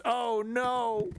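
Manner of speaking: high mock gasp of dismay; mock alarm. Mocking covid deniers with a high fake-horrified oh no.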